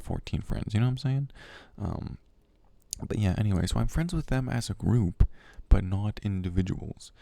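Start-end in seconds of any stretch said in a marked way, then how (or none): none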